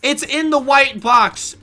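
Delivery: curt reply. Retold or imitated. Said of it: "I said".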